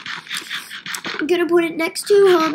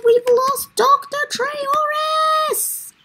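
high, drawn-out wail of mock despair; mock panicked wail; 10 semitones higher, much slower than usual. Announces villager lost, mock panic.

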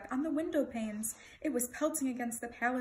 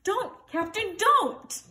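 loud, high cry to stop; desperate shout; 10 semitones higher, 10 dB louder, much slower than usual. A narrator's reading. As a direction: shout in alarm, climbing on the last word. Bartholomew trying to stop the captain.

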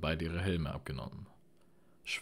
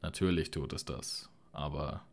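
plain reading voice

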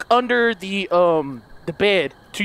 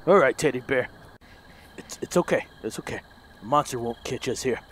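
reassuring; 7 semitones lower, 6 dB quieter than usual. Real, not acted. Soothing the teddy bear.

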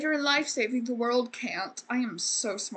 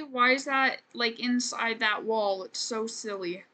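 normal talking voice

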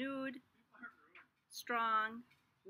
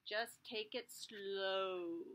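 slow and gentle; 3 semitones lower, much slower than usual. Acted. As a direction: ease it out calmly. Gentle advice.

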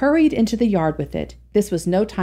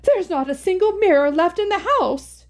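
moaning; 11 semitones higher than usual. Acted. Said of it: "she moaned".

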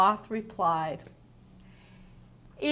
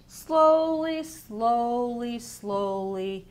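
drawn-out story refrain; 2 semitones higher, 4 dB louder, much slower than usual. A narrator's reading. Teacher reading the sloth book to children, stepping down the refrain.

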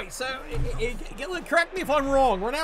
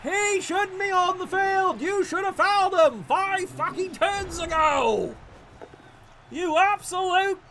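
angry rant; 6 semitones higher than usual. Real, not acted. Ranting at opponent's play.

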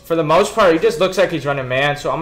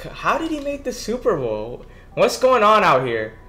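high, disbelieving question, pitched up; incredulous; 7 semitones higher than usual. Real, not acted. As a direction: ask it like you can't believe it. Baffled at opponent reaching Super Bowl.

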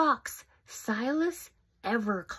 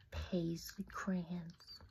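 ordinary delivery, nothing marked

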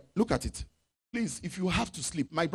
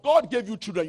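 ordinary talk

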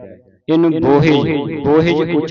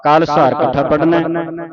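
ordinary delivery

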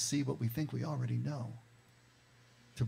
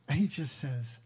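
usual speaking voice

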